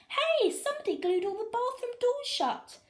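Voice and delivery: high, loud crowd shout; shouting fans. A narrator's reading. Fans shouting at the game, high loud call. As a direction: yell it across the stands. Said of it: "people shouting everything from".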